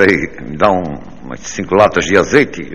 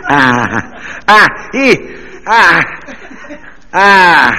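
much higher, louder mock ecstasy cries; hammed-up moans; 10 semitones higher, 5 dB louder, much slower than usual. Comic reaching the climax, yelping ah. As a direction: peak the moans high and loud.

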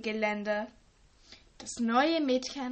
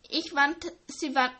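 ordinary delivery

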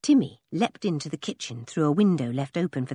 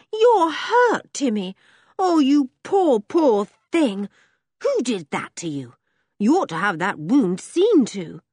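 high horrified pity; girl Henry, horrified; 6 semitones higher, 4 dB louder than usual. Acted. Girl seeing the dog's cut, high pitying cry. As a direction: cry out over the wound.

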